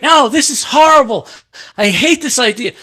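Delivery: high, loud, exasperated imitation; retold angry rejection. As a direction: act out a boss hating the idea. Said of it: "he gets so mad".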